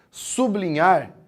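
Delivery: "sublinhar" with BL blended, su-BLI-nhar; pronúncia errada. Shown as a demonstration. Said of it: "Assim tá errado". ("sublinhar" com o BL junto: su-bli-nhar)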